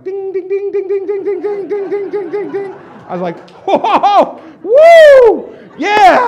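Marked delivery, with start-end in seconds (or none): 0.0-2.7 rapid-fire high ringing dings; cash-register dinging. Retold or imitated. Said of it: "I started just like". 3.7-6.3 huge high whooping cheer; wild celebration. Retold or imitated. Celebrating eleven sign-ups.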